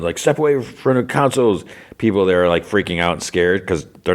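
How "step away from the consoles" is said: retold yell. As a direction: imitate an armed man ordering everyone back. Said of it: "yells for everyone".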